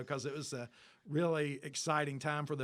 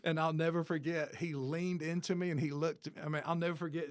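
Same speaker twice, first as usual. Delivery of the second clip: normal talking voice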